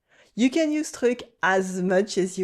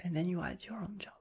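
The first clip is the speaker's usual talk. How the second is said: whispered aside; 2 semitones lower, 14 dB quieter, faster than usual. Real, not acted. Aside during repeat drill.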